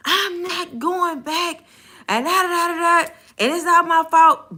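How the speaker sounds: high, drawn-out mock rant; ranting customer. Customer refusing to go back to the bank, mock ranting. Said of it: "she went on a whole nother rant".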